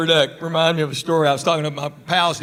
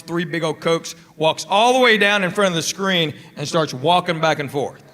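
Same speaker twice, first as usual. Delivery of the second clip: ordinary talk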